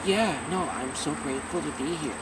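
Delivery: low, soft, toned-down reply; subdued. Acted. Answering an interviewer without any shouting, subdued. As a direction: drop it low and murmur thanks. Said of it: "Instead of just like".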